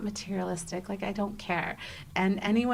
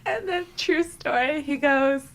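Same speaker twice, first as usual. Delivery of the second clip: words squeezed through real laughter; laughing; 8 semitones higher, 6 dB louder than usual. Real, not acted. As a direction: laugh helplessly through the line. Laughing while retelling funny moment.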